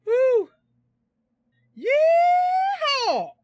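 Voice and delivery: loud, high, drawn-out yeehaw; exultant whoop. Real, not acted. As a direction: whoop like a rodeo cowboy. Whooping after the win.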